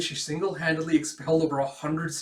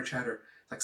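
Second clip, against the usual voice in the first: usual speaking voice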